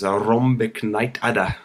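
slow, drawn-out imitation of a north-east English dustman; exaggerated dustman accent. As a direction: overdo the Geordie dustman's accent, stretching each word. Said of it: "the exaggerated way he says".

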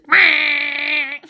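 high, long wordless squawk; drawn-out mock chaos noise; imitated blare. Dreading the house filling up, high squawk.

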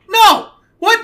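sudden very high, loud yelp; mock outburst. Dropping the bit, mock shocked high yelp. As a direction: blurt it out in a high squeal.

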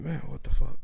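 whispered, startled curse; retold whisper. Woken by knocking at night, a whispered startled curse. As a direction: hush it like you just woke up scared. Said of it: "I'm like".

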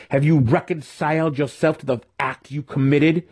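high, pressing accusation; spat out. A narrator's reading. Mentor accusing his student of killing, spitting it out. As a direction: press him with a pointed question. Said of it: "he spat".

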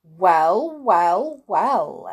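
loud, drawn-out wizardly exclamation; exclaimed. A narrator's reading. Wizard eyeing money rolling over the floor, exclaiming. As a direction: exclaim each word separately, with weight. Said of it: "exclaimed the wizard".

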